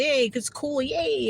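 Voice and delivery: high, sing-song fake cheer; mock cheerful. Telling family how she expected home, mock chirpy cheer. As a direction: chirp it like naive happy hopes. Retelling her hopeful thoughts back home.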